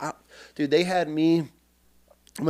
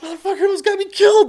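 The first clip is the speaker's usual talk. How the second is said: high, fast, breathless exclamation; frantic retold outburst; 4 dB louder, much faster than usual. Recruit who just fled into squad bay, frantic high blurt. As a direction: blurt it in comic high-pitched outrage.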